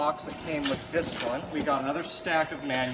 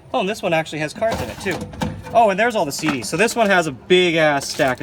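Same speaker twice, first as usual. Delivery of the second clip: excited discovery; 4 semitones higher, 7 dB louder than usual. Real, not acted. Finds cards and CDs inside.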